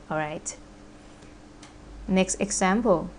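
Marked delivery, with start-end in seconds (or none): none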